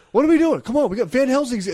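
high, loud impatient boss rounding up the crew; rally the slackers with a shout; boss yelling. Retold or imitated. Boss rounding up idle workers.

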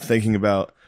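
ordinary talk

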